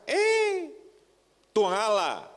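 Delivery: very high, loud Ambonese call; loud Ambonese exclamation at the spouse; Ambon speech. Retold or imitated. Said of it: "Nadanya memang tinggi".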